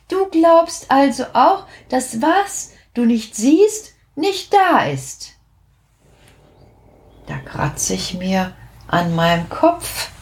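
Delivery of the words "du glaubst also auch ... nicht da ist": little witch teaching a lesson, higher. A narrator's reading. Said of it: "sagt die Perdita". (kleine Hexe, belehrend)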